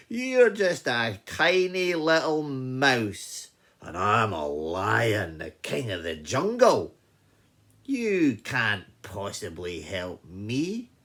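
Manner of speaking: loud, slow king of the jungle; scornful boasting lion. Acted. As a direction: boom it out, drawing out each phrase.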